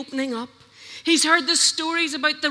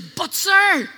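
louder, higher doubtful protest; My version says; 7 semitones higher, 9 dB louder than usual. Retold or imitated. Preacher mocking Gideon's reluctant reply, whiny protest. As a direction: throw up a whining "but, sir". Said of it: "My version says".

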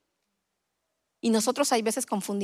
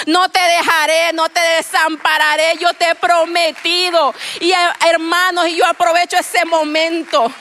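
preaching at full force, louder and higher; predicación fervorosa; 5 semitones higher, 7 dB louder than usual. Acted. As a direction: proclaim it to the congregation. Predicando a toda voz. (predicando a toda voz, más fuerte y más agudo)

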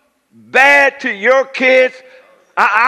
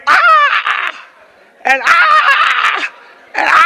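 high yelled stabbing grunts, staged; mock stabbing cries; much slower than usual. Shown as a demonstration. Preacher staging a stabbing for the congregation, mock high cries. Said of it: "we take this knife and we".

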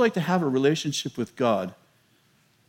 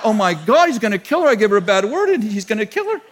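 higher, louder retold panic; mock panic; 5 semitones higher, 5 dB louder than usual. Preacher retelling his fear the Lord would kill her, mock panic. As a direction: replay the alarm half-laughing. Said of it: "I seriously had a panic attack".

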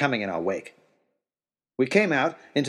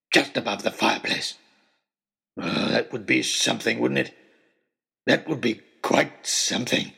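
menacing trapper. Acted. Trapper gloating, twisted smile.

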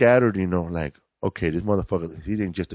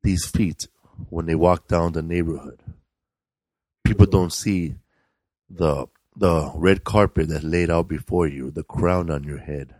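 romantic poem recital; 4 semitones lower than usual. Shown as a demonstration. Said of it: "mine was".

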